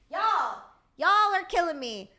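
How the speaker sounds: a touch of Southern twang; southern accent. Real, not acted. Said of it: "Why did I turn so southern".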